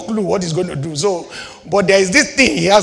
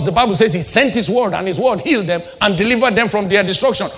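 normal talking voice

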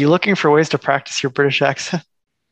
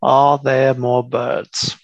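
slow, drawn-out mock British accent; much slower than usual. Practising after looking up a how-to, slow British accent. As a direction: stretch each word in a put-on British accent. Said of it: "how to do a British accent".

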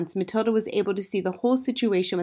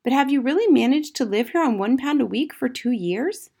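put-on high child's voice, incredulous; amazed young Matilda; 7 semitones higher, 5 dB louder than usual. A narrator's reading. Matilda asking about one pound a week, amazed high voice. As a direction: ask like an astonished little girl.

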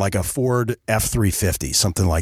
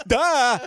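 unflattering yelp of fright, high-pitched; 13 semitones higher than usual. Retold or imitated. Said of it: "he makes a sound that is not flattering".

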